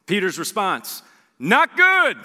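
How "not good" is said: high, loud deflated answer; glum Peter. Pretend. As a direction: blurt it out, defeated.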